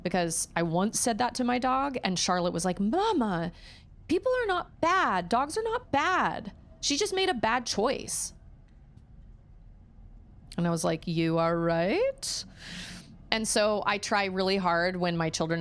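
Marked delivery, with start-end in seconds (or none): 2.8-8.3 put-on high, slow childlike correction; little daughter's voice. Charlotte telling Mama no one is bad, in a high child voice. 11.1-12.4 slow concession. Retold or imitated. Mom conceding to daughter.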